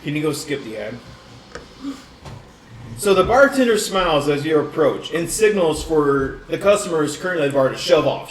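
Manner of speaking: normal talking voice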